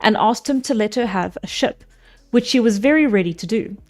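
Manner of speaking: steady storybook reading voice; story narration. A narrator's reading. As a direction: read it like an old epic tale. Reads the Odyssey aloud.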